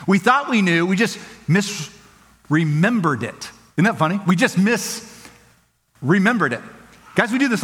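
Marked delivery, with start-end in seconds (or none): 0.9-3.5 slow, with weight on "just"; slow and emphatic. Shown as a demonstration. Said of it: "emphatic repetition". 4.3-6.6 slow again, "just" leaned on; same slow weight; slow and emphatic. Shown as a demonstration. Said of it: "emphatic repetition".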